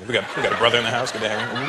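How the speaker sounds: ordinary talk, nothing marked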